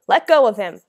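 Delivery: loud, urgent shouted command; full-voiced protective shout. A narrator's reading. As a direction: shout to make the attacker release him. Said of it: "I shouted".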